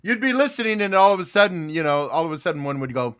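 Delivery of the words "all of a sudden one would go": usual speaking voice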